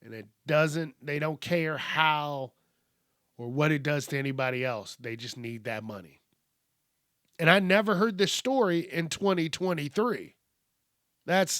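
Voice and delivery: usual speaking voice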